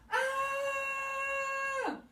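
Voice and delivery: high, long held scream. Acted. Girl waking from a nightmare, a high scream. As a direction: scream from a bad dream. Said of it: "And she screamed".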